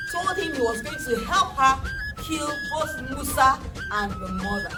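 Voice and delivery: usual speaking voice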